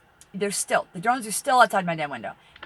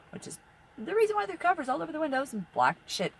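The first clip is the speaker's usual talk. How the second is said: ordinary delivery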